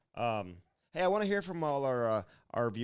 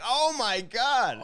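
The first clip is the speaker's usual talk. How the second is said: mock shock; 8 semitones higher, 6 dB louder, much slower than usual. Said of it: "I'm like".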